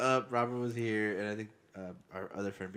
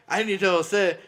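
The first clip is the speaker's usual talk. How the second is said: excited; 8 semitones higher, 11 dB louder than usual. Real, not acted. Excited retelling of late night.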